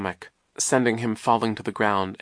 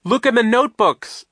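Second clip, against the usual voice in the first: high, loud instruction; high-pitched shout; urgent cry; 9 dB louder than usual. A narrator's reading. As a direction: cry it out desperately. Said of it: "Isadora cried".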